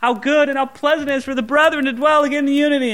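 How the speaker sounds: high, gushing, delighted worship voice; mock blissful praise. Preacher mimicking believers at peace, high gushing praise. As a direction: gush with happy praise, lightly mocking. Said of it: "everything's peaceful spiritually".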